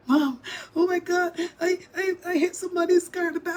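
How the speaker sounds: high, fast panicked call; panicked daughter. Retold or imitated. Daughter phoning after crash.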